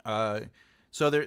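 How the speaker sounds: usual speaking voice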